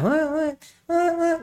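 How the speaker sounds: a high, varying mock infant wail; high baby cry imitation. Shown as a demonstration. Doctor demonstrating how a colicky baby's cry jumps high. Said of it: "kadang-kadang nada tinggi".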